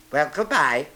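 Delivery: a hint of a storybook animal; Rabbit. A narrator's reading. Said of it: "said Rabbit".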